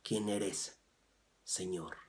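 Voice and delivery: hushed reverent question. Shown as a demonstration. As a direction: ask it softly, in reverence. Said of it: "¿Te das cuenta cómo dice?".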